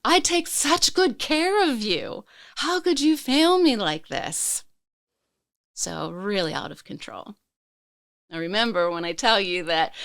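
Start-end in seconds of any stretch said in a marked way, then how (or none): 0.0-4.6 high, raised-voice anguished accusation; berating. Retold or imitated. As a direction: re-enact scolding your own body, pitched up and pleading. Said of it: "basically berating my body".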